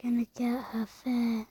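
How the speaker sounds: soft unvoiced whisper; sneaky hushed threat; whispered plotting. Real, not acted. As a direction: scheme in a whisper. Secret plotting aside.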